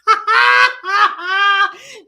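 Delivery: loud high cackle of delight; explosive laugh; burst of laughter. Real, not acted. Reacting to the rant clip.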